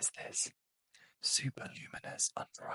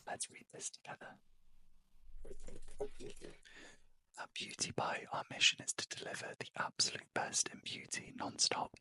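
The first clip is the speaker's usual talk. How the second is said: ASMR whisper. Real, not acted. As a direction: whisper close to the mic, winding down for sleep. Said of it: "where I'm not talking like this".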